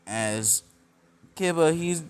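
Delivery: ordinary delivery, nothing marked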